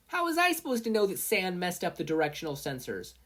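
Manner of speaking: put-on girl snapping back in a squabble; whiny inventor girl. A narrator's reading. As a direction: pout it out, sulky and sore. Mei arguing with Mina.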